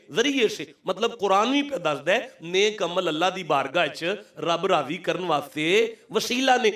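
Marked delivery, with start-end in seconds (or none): 6.1-6.7 high, swooping sermon appeal; impassioned preaching. Real, not acted. Preacher appealing to rival sects to accept his point, impassioned. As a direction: press the argument on the whole congregation.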